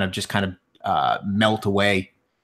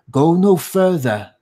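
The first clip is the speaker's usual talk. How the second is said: high, louder elf sentry voice; disembodied warning; 9 semitones higher, 5 dB louder than usual. Acted. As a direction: warn them off from hiding, higher pitch. Disembodied elf voice warns the party.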